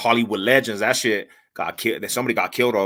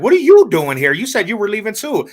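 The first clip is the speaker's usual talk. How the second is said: high, mock-surprised girl's voice; 9 semitones higher, 4 dB louder than usual. Teller imitating the girl who caught him, high and surprised. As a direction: pitch up into her startled question. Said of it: "She's like".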